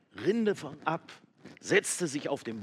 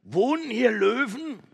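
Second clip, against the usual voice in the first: much higher, loud shout; loud call; 12 semitones higher, 10 dB louder than usual. Acted. Goat calling into the cave again, loud. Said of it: "Ganz laut rief sie wieder".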